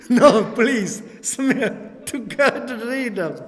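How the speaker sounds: loud, slow refusal broken by laughter; patient laughing as he refuses to keep his leg. Retold or imitated. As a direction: laugh out a horrified no thanks.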